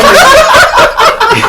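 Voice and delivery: high slow laughing restart; laughing drawn-out. Real, not acted. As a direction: stretch it out while laughing.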